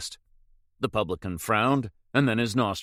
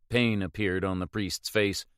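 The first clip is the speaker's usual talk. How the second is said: usual speaking voice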